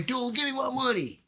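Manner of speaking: high, mock-timid demand. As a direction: pretend to ask, knowing you never would. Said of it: "You think I'm gonna say".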